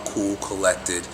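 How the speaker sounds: deep, calm voice. Shown as a demonstration. Coach signing off in a low, grounded voice. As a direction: drop it deep and easy. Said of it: "Speak from a deeper place".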